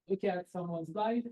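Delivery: normal talking voice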